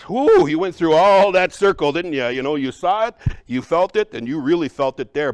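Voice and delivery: loud, lively praise for the student; excited praise. Real, not acted. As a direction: pump them up after their try. Cheering the student on.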